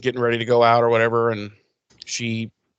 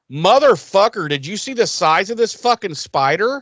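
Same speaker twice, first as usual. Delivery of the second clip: higher, incredulous outburst; mock shock; 6 semitones higher than usual. Host reacting to a giant spider on the table, shocked. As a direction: exclaim in exaggerated disbelief.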